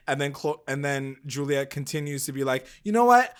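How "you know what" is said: higher, louder, fast rant; imitating a friend losing it; mock rage. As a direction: rattle it off in a jealous fury. Voicing Juliet's rage.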